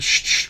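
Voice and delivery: voiceless mock "shh, shh"; playful shushing. Streamer hushes excited Dwight before his song, mock shushing. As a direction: hush someone in pretend reproof.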